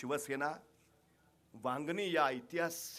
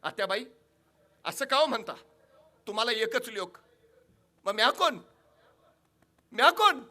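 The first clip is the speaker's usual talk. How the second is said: building loud, much higher vow to fight; defiant wife; 10 semitones higher, 15 dB louder than usual. Acted. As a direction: rise to a fierce battle vow. (जिद्दीने मोठ्याने घेतलेली शपथ)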